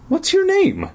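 ordinary delivery, nothing marked

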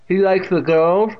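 high, loud teasing question from a deaf aunt; Catherine. A narrator's reading. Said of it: "with that distinct pronunciation".

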